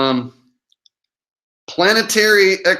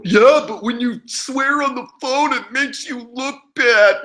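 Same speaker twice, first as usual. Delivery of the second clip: mocking a critic; 8 semitones higher than usual. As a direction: parrot the complainer in a silly squeak. Mimics a critical viewer he blocks.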